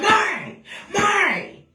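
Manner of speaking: put-on high-pitched cry of the child's name; urgent calling out. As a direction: call out to wake your child, pitch leaping up. Calling to wake her sleeping son.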